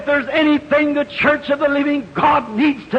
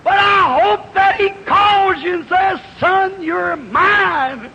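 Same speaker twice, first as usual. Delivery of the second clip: preaching shout; 4 semitones higher, slower than usual. Acted. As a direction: shout it out to the church. Preaching climax.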